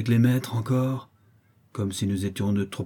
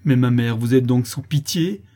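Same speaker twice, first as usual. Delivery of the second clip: pleading daughter, louder and torn; heartrending cry; 2 semitones higher, 6 dB louder than usual. Acted. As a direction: cry out to her mother in anguish. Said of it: "d'une voix déchirante".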